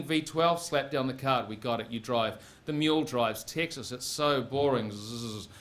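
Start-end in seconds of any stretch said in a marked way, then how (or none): none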